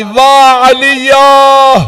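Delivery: very slow wailed cry of Aliya; long keening lament; lamenting call. Acted. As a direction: draw the name out like a keening call. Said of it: "फिर आवाज दी".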